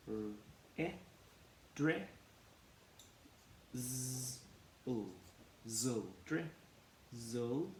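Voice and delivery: sounds of "drizzle" split apart one by one, slow; sounding out drizzle. Shown as a demonstration. Teacher segments drizzle for the class, sound by sound. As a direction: break "drizzle" into its sounds, then blend "dri, zul". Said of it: "And we say".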